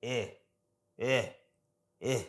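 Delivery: short, separate "eh" grunts, barely formed; lazy little "uh" sound, repeated with pauses; under-pronounce each "eh" as a schwa. Shown as a demonstration. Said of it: "it's really lazy".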